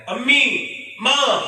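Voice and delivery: calling out to a mother who won't answer; anguished call. Retold or imitated.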